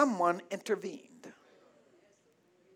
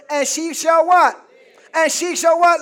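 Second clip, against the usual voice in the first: shouted call for response; 6 semitones higher, 7 dB louder than usual. Acted. Preacher calling for response.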